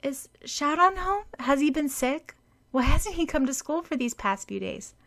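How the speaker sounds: high, eager worried questions; anxious girl asking about her absent friend; anxious young girl. A narrator's reading. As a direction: press for answers about him.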